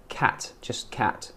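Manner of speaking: "cat" with a quick, light T; light final T. Shown as a demonstration. Teacher correcting a learner, "cat" with the T not held. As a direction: say "cat" without holding on the T. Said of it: "hold on the t".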